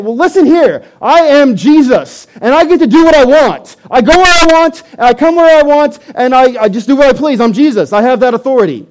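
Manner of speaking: loud, high, defensive outburst; booming self-important comeback, pitched high. Acted. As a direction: assert your authority, defensively and loudly. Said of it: "in a very defensive mode and saying".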